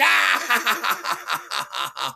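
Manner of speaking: loud, stuttering "aaah" of pain; mock-agonised yell; acted pain howl. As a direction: wail in broken pulses after the crash. Acts out pain on landing.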